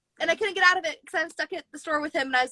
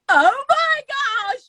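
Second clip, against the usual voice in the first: high, slow, stunned oh my gosh; recalling the moment the date confessed; shocked gasp; 12 semitones higher, 6 dB louder, much slower than usual. Retold or imitated. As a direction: pitch way up in disbelief.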